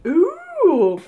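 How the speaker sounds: high, loud delighted ooh; a quick thrilled coo at the box; excited ooh. Real, not acted. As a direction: squeal it with delight. Reacting to a new item.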